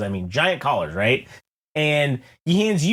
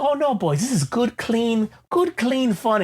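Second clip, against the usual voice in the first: higher, coaxing hammed-up buddy voice; pushy host waving off worries, hammed up; mock reassuring Farrell; 6 semitones higher than usual. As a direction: sell the clean fun with fake innocence. Colin Farrell insists, reassuring.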